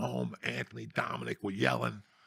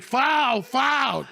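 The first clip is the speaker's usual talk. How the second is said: much higher, louder yelling of Foul; 12 semitones higher, 8 dB louder than usual. Retold or imitated. Kids yelling Foul at the one-eyed ref. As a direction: call Foul at the ref like a kid.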